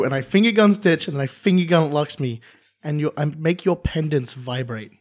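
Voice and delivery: ordinary delivery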